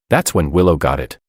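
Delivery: usual speaking voice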